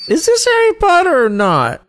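high, goofy confused henchman; dumb henchman voice. Retold or imitated. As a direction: play a clueless dark wizard squeaking. Mocking dumb dark wizards.

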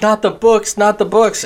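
high mock pleading; pleading librarian. Librarian begging the robbers, high pleading. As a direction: beg high for the books, not yourself. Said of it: "she started like".

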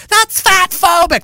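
high, put-on mocking voice; sneering mock of a critic; mocking shrill voice. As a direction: parody the accuser with a high whine. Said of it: "mocking opponents".

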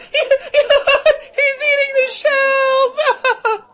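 high, slow words through loud laughter; laughing crowd. A narrator's reading. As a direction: howl it out between laughs. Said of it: "laughed the crowd".

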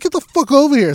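retold angry yell. Owner repeating his order to the dog, loud and exasperated. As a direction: holler it at the dog.

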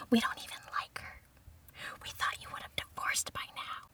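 hushed whisper imitating parents; whispered disapproval. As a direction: whisper it like a scandalized secret. Parents' secret disapproving reaction.

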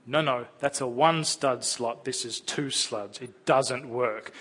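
slow, patient explaining; patient parent. Retold or imitated. Parent explaining to a child why the Lego piece won't fit, slow and patient. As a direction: spell it out slowly to a small child.

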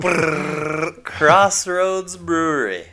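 loud, high, slow shivering announcement; shiver, then belt out the brewery name. Pretend. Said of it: "All right, retake".

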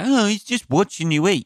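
mock high-pitched parent voice; defensive parent. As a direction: brush it off in a high parental whine. Parent excusing their precious kid.

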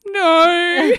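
high, loud mock wail; wailed refusal. Mother in labour answering Renee's offer, high loud mock wail. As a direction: cry it out in mock protest.

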